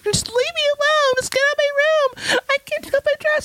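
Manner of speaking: very high-pitched mock teen meltdown; hammed-up high, frantic whine; freaking out, imitated. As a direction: squeal it like a panicking girl. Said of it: "she's, like, freaking out".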